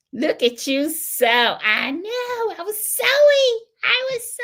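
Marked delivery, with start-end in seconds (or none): 1.6-4.4 much higher, delighted squeal; excited squeal. Real, not acted. Quilter answering a viewer's compliment, squealing with delight.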